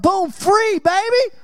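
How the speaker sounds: high, exuberant boom; excited boast. Retold or imitated. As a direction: crow it like a winner.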